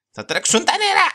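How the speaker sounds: very high, loud yell; sudden yell. Real, not acted. Player reacting to water about to run, sudden high yell. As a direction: shout it out at the top of the voice.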